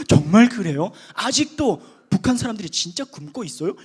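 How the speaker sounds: loud, astonished question; mock surprise. Preacher imitating a churchgoer surprised that North Koreans starve, loud mock astonishment. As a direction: act out a naive churchgoer hearing news for the first time.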